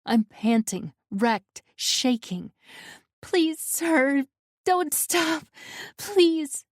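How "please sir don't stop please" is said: high, tearful begging; sobbing plea. A narrator's reading. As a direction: beg through sobs. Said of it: "The sobs shake my throat".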